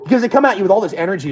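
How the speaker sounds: ordinary delivery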